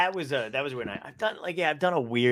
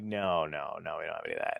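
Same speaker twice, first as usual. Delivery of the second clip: lightly voiced dismissive staff, lower and softer; a hint of a flat-out no, pitched lower and softer; 8 semitones lower, 7 dB quieter than usual. Staff voiced in retold story.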